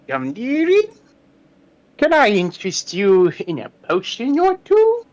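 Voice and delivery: high, sing-song crone offering potions; old witch voice. Acted. Witch at her cauldron calls to the visitor in a high crooning voice. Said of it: "just kinda goes".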